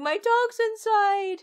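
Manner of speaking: high, drawn-out mock scream; hammed-up kid's cry for help. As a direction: yell it loudly like a panicked child. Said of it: "the kid is screaming".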